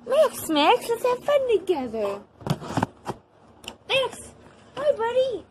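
very high sing-song voice to the dog; sweet high pet voice. Real, not acted. Girl calling her little dog to play, high baby talk.

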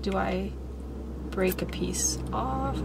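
quiet unvoiced wondering; whisper. Real, not acted. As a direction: whisper it to yourself.

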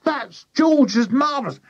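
high-pitched urgent warning; high character voice in alarm; alarmed cry. A narrator's reading. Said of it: "cried Missus Cranky".

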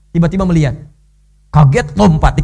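ordinary delivery

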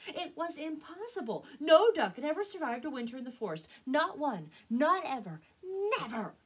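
high, loud insistent protest; emphatic disbelief. Acted. Flock duck seeing papa alive, emphatically disbelieving. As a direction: declare it with rising force, phrase by phrase.

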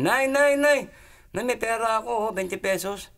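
acted-out child bursting with news; excited child. Child runs home calling mother.

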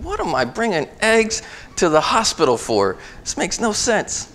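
high, incredulous what-on-earth musing; baffled inner thought. Retold or imitated. Son baffled at being sent to the hospital with eggs, incredulous.

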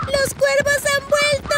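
very high, loud cry; little girl calling out in alarm; piercingly high child's yell; girl's shout. A narrator's reading. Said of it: "gritó una de las niñas".

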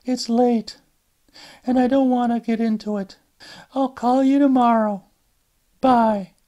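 tired daughter excusing herself to her mother; Alice on the phone. Acted. Alice ending the call.

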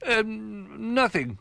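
high, hesitant stammer; flustered cover-up. Acted. Rabbit hiding the secret from Old Bear, flustered. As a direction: fumble for an innocent answer.

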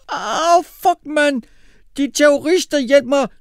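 very high, loud panicked scream. A narrator's reading. Mohammed with his wreath on fire, screaming for help. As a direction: shriek for help in pain. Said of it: "lød der et skrig".

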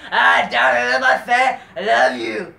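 high, loud mock wrestler fawning over his fans; mock wrestler calling out to his fans, loud and high. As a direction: ham up a cheesy babyface thanking the crowd. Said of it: "some dude named Dominic".

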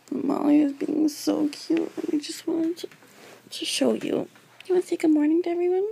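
high cooing pet voice; talking to dog. Real, not acted. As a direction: coo sweetly at the puppy. Cooing over her cute dog.

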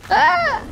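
high-pitched short scream; startled yelp. Real, not acted. Player hit mid-fight in a game, high startled yelp. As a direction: yelp in sudden alarm.

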